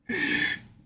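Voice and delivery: voiceless laugh of disbelief; amused laugh. Real, not acted. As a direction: crack up without voice. Laughing at the replayed clip.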